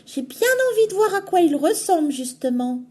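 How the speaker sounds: put-on high, fast eager girl voice. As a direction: rattle it off, eager to see the wolf. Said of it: "répond le chaperon rouge".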